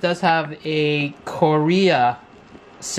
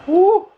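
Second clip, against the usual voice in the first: loud whoop; 14 semitones higher, 6 dB louder than usual. Real, not acted. Reacts to the spicy food.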